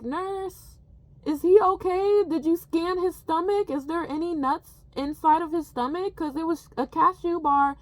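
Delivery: high, anxious questioning; worried. Retold or imitated. Assistant anxiously asking the nurse about the boy, worried. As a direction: rush the questions out in worry.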